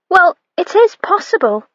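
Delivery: put-on high, insistent young maid; insisting maid. A narrator's reading. Young maid insisting to her mistress. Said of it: "the girl insisted".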